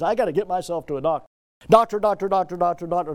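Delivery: rushed, fretful chant of doctor; frantic mock worry. Preacher mocking a sick person's fretting, rushed and anxious. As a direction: mimic someone panicking about doctors.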